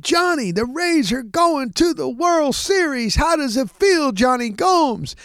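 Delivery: high-pitched interview over a roaring crowd; excited interviewer. Retold or imitated. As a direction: call it out high, like a celebration reporter. Retold interview amid pennant celebration.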